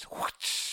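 short breathy whip whoosh; whip noise. Pretend. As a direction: snap out a whip sound. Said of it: "Why are you doing a whip noise?".

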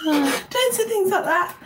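high, teary laugh through the words; laughing through tears. Real, not acted. Guest laughing through tears at the host's kindness. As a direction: laugh it off while close to crying.

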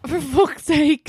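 spluttering out a drink; spit take. Real, not acted. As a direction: sputter mid-sip. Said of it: "I just spat all over my laptop".

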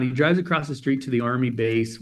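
ordinary talk, nothing marked

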